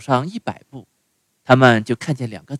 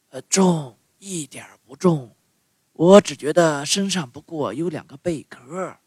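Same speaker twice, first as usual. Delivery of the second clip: tuna voice; 4 semitones higher, 3 dB quieter than usual. A narrator's reading. Tuna speaks.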